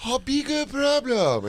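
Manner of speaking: put-on high, slow caricature Chinese accent; hammed-up fake Chinese accent; Mickey Rooney. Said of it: "Mickey Rooney Chinese voice".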